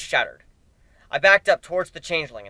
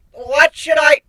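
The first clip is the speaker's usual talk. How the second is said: high, loud startled changeling; flustered question; 12 semitones higher, 9 dB louder, slower than usual. Acted. Changeling asking what to play, a high flustered blurt. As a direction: blurt it out confused.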